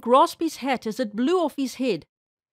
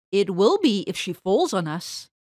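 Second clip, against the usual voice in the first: plain reading voice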